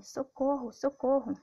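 crying for help. Acted. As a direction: shout for help, rising alarm. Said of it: "ela gritava pedindo".

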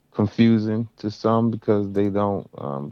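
usual speaking voice

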